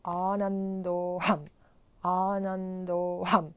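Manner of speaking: Anandoham slow, each syllable drawn out; careful mantra pronunciation. Shown as a demonstration. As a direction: say Anandoham slowly, every syllable clear. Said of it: "pay attention to the proper pronunciation".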